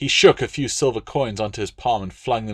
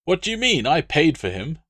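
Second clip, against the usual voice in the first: high, loud aggrieved noble; pompous Roman legate, put out; indignant legate; 7 semitones higher, 3 dB louder than usual. Acted. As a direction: protest like a spoiled patron. Latius the legate protests.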